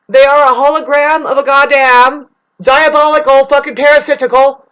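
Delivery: loud, high, fierce tirade; loud angry rant; heated rant. Real, not acted. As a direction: spit out every word in anger. Ranting about a satanic system.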